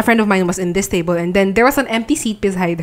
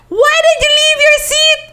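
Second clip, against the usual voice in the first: very high retold screech; screaming; 3 dB louder than usual. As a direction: burst in screaming an accusation. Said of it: "I started screaming at her".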